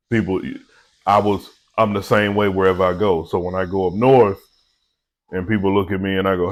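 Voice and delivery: ordinary talk, nothing marked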